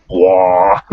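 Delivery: very low bullfrog croak; deep bullfrog cry. Acted. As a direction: let out a big creature's deep bellow. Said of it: "It's just this very deep".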